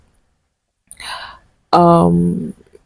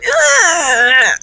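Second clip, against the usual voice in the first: labour cry; 11 semitones higher than usual. Retold or imitated. Pushing in labour, retold.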